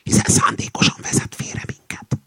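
insistent voiceless whisper. A narrator's reading. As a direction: whisper it urgently to a companion. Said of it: "súgta oda a kakas". (nyomatékos suttogás)

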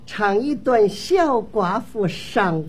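slow, drawn-out title announcement; 模仿演员报曲名. Acted. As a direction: stretch it out like a ballad singer. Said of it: "我连程玉兰上场都学一学啊。". (慢慢拖腔报曲名)

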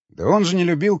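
ordinary delivery, nothing marked

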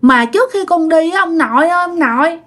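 put-on high, wheedling little child; whiny child. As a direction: coax grandpa like a spoilt kid. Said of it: "làm theo kiểu như con nít mà nhõng nhẽo".

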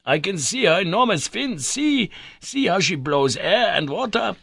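put-on high, loud excited old man; excited professor. A narrator's reading. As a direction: point it out eagerly. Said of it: "shouted the professor".

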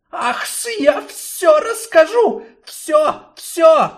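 high, loud, frantic hissing; desperate confession. Acted. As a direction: blurt it all out in panic. Snake breaks and confesses.

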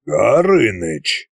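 slow, drawn-out warning; threatening drawl. A narrator's reading. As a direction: stretch the name out as a threat. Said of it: "с угрозой протянул царь-батюшка".

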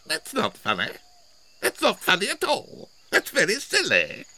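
high-pitched little rabbit voices; put-on high voice, mildly indignant. A narrator's reading. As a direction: squeak it out crossly, high and rising. Indignant rabbits popping out of hole.

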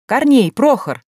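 calling out. A narrator's reading. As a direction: call two names to someone indoors. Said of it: "за окнами раздался крик". (громкий оклик)

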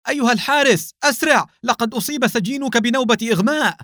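high-pitched urgent call for help; urgent shout, pitched very high throughout. A narrator's reading. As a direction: cry out to summon the guard at once. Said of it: "وصاح بأعلى صوته".

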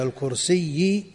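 الكرسي with a doubled final ya. Shown as a demonstration. Teacher comparing the ya of attribution, doubled ya. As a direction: press the ya double at the end of الكرسي. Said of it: "ياء كيا الكرسي".

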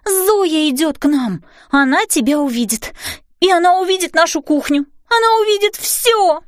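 high, alarmed warning building to a peak; panicked. A narrator's reading. Girl warning fairy mother Zoya is coming, panicked. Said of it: "в панике закричала я".

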